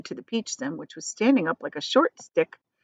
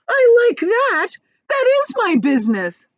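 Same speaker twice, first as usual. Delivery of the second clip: much higher, louder outraged cry; indignant cry; 9 dB louder than usual. Acted. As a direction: protest with wounded pride. Earthworm outraged at centipede.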